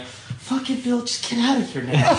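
high-pitched retold outburst; acted high-voiced panic with a laugh; mock flustered protest. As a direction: squeal it as a joking plea to leave. Acts out reaction to being watched.

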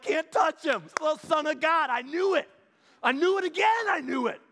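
gleeful gloating. Pretend. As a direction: crow like a smug kid who knew it. Imagined disciple gloating.

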